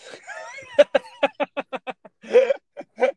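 loud, high burst of laughter; big laugh. Real, not acted. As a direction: crack up out loud at the absurdity. Co-host cracks up at story.

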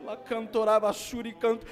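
usual speaking voice

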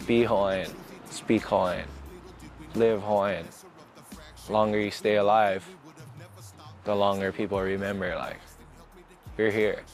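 normal talking voice

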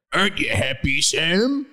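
put-on thick accented character voice, rising at the end; Hagrid. Hagrid asks Sam a question, in character voice. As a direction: ask it as the lumbering character. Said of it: "asked Hagrid".

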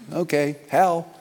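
ordinary talk, nothing marked

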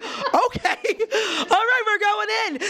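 high, drawn-out laughing retort; laughing comeback. Retold or imitated. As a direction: crack up while quoting your cheeky reply. Jokey retold comeback, laughing.

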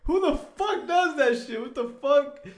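very high, loud incredulous yell; mock outraged disbelief. Friend appalled that he always cooks pizza so, mock outraged. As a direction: imitate a friend blowing up in disbelief.

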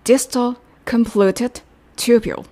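"distal convoluted tubule" spelled out slowly, each syllable clear; careful teacher's demo of "distal convoluted tubule"; slow model pronunciation. Shown as a demonstration. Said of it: "遠位尿細管".

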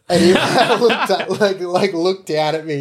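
talking through real laughter, high and loud; high, loud voice broken by laughs; laughing. Real, not acted. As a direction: crack up while telling the story. Telling a funny story, laughing.

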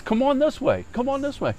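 very high imitated challenge; mock calling out. Acted beckoning to a fight.

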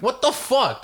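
high, indignant retort; exasperated disbelief. Retold or imitated. Patient turned away again by the clinic, exasperated. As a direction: jump up high in retold exasperation.

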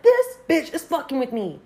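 high, annoyed venting; irritated. Retold or imitated. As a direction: push the pitch up, fed up. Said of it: "I'm getting irritated".

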